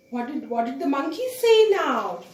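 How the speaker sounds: plain reading voice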